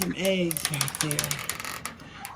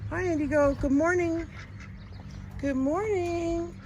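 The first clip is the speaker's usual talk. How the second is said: much higher, sweet greeting; high cooing; 9 semitones higher than usual. Real, not acted. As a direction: coo up high at the bird. Cooing to bird.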